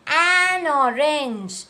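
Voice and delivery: an orange said slowly with weight on an; drawn out so the class hears the answer an orange; slow stressed answer. Shown as a demonstration. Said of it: "So, we should write".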